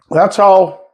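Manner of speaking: talking through hiccups; hiccuping. Real, not acted. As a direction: hiccup mid-sentence. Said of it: "excuse me, I'm hiccuping".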